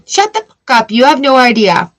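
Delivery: imitating the voice in her head; inner angry outburst. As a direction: let the inner rage burst out.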